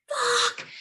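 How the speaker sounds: a sudden high cry of alarm; panicked curse. Retold or imitated. Teen sees the carpet catch fire, high alarmed curse. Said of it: "So I'm like".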